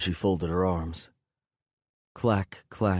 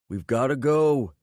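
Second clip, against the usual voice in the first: higher, pushed call, falling on go; urgent yell; 7 semitones higher, 4 dB louder than usual. A narrator's reading. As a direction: shout to drag him out. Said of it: "Jake yelled".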